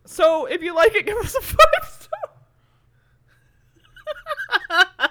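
high, loud laughing plea. Real, not acted. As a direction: giggle through the request. Laughing through the sign-off.

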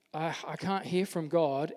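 usual speaking voice

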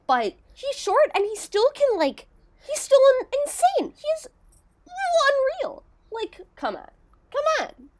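gushing over an undersized cornerback. Real, not acted.

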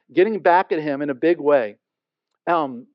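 ordinary talk

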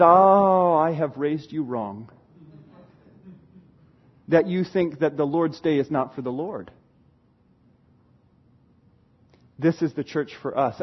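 very low, quiet mock dad rebuke; disappointed father. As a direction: sigh it out softly as the grave dad.

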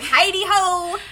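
high, slow, laughing nerdy hello; goofy greeting. Retold or imitated. Host imitating Melvin's goofy greeting, high and slow. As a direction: stretch the greeting out like a dorky classmate. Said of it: "So he's like".

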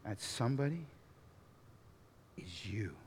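soft, solemn near-whisper at the end; hushed challenge. Real, not acted. Preacher telling listeners that somebody is them, hushed. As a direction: drop to a hush on the final words.

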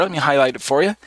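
ordinary talk, nothing marked

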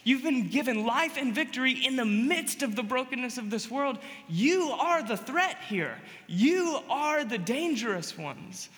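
forceful pulpit proclamation; emphatic preaching. Real, not acted. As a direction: preach it with punch on each key word. Preacher declaring to the congregation.

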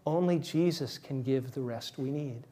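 ordinary talk

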